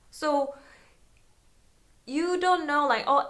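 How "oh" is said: ordinary talk, nothing marked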